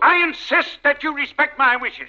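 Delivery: high, commanding rebuke. Acted. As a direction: lay down the law to the son. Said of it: "Your voices are so loud".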